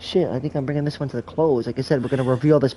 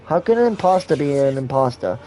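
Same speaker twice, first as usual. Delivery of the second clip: ordinary talk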